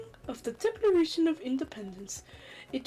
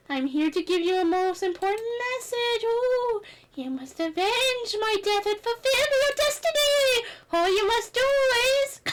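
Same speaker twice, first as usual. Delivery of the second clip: spirit voice; 8 semitones higher than usual. Acted. Spirit delivering prophecy.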